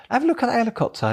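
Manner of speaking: excited pointing out. Retold or imitated. Pointing out helicopter to son.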